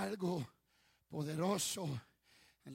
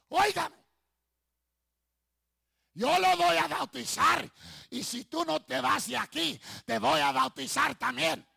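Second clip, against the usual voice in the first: high, very loud retold outburst; fed-up pastor; 9 semitones higher, 15 dB louder than usual. Fed-up pastor warning the demon he will baptize, shouting. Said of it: "se fastidió el pastor y le dijo".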